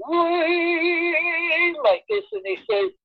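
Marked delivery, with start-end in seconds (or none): 0.1-1.8 high, sung-out whee like a head buzzing. Retold or imitated. Telling friends how his head buzzed, a sung whee. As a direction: let the whee ring out like a dizzy head. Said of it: "it's just buzzing. It's going".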